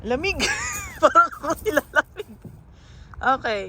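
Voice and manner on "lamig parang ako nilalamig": ordinary delivery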